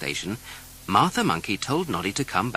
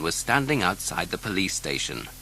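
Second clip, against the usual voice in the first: ordinary delivery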